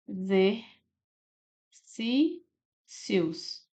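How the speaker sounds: "exercícios" very slow, every syllable on its own. Shown as a demonstration. Teacher showing the other division, "exercícios" syllable by syllable. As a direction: pause long between the syllables of "exercícios". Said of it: "E como que seria?".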